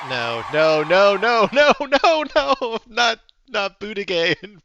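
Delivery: horrified shouted protest against the prediction; panicked no. Real, not acted. Reacting to Buttigieg prediction.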